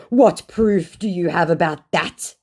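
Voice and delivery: high, loud, slow challenge; drawn-out mock indignation; mock scammer. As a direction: demand it in a put-on scammer voice. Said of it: "he said".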